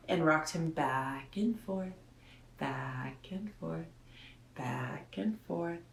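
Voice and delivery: slow, soft, rocking rhythm; slow soothing rocking. A narrator's reading. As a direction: read it as if rocking a baby. Reading rocking lullaby slowly.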